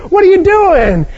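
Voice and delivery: a woken man caught off guard; startled neighbor. Acted.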